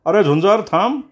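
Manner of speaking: calling after someone. A narrator's reading. Friend running up behind calling him to stop. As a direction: shout after him as you run up.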